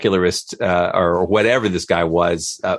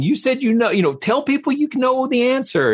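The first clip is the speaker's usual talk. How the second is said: high, mocking voice of someone demanding easy answers; mocking a demagogue. Skeptic mocking what people want to hear, high mock voice. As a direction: pitch way up, sneering at complexity. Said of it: "it's like".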